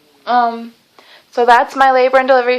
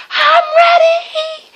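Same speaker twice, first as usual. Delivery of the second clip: reenacted yell, much higher and drawn out slowly; yelled call; 4 dB louder, much slower than usual. Calling down the hallway for the nurse, a high drawn-out yell. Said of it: "I yelled down the hallway".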